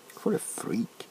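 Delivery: a rude remark whispered under the breath; whispered aside. Acted. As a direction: whisper it so the patient won't hear. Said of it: "I said it's raining outside".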